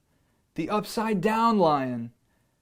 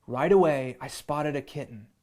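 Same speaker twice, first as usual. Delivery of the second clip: ordinary delivery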